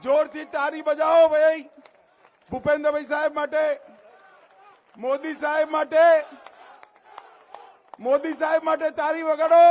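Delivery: loud, high rallying call; real rally shout; calling for applause. Real, not acted. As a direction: whip the crowd into applause.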